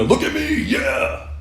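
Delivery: high, lightly hammed-up bragging; mocking a preening tough guy; mock show-off. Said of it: "You know".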